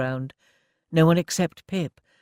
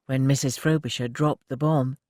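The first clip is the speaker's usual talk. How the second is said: usual speaking voice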